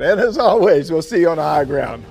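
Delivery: booming rallying sign-off; loud gung-ho soldier. Real, not acted. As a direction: call it out like a sergeant major. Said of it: "gotta get this one".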